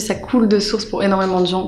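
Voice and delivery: ordinary talk, nothing marked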